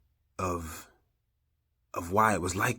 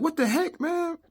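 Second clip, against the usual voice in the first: much higher angry exclamation; mad outburst. Retold or imitated. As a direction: fling it out in disbelief and anger. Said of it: "I was so mad".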